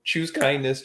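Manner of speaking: normal talking voice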